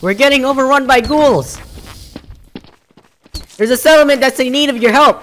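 urgent militiaman raising the alarm; high, loud, projected role-play voice; Preston Garvey. Acted. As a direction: report the attack like a settlement defender.

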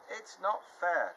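high, loud and slow protest; shouted. Acted. Losing team shouting at the winners, loud and slow. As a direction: drag it out, loud and indignant. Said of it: "the spiders on Scrabble's team shouted".

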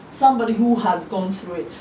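normal talking voice

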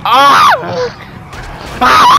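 loud high startled screams. Real, not acted. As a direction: yell out in shock. Screams in the chaos.

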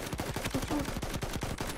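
stunned whisper. Real, not acted. Stunned by what happened in game.

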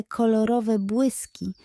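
plain reading voice

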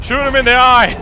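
high, loud urging yell; battle shout. Real, not acted. Gamer urging the attack, high loud battle yell. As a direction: egg on the attack at full voice. Fighting in game.

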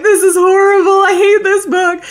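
playfully dramatic book despair; mock anguish. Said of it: "I'm like".

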